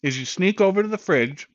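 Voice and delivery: talking through a mouthful of food; words round a bite of steak; mouth full. Real, not acted. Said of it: "I'm just gonna talk with my mouth full".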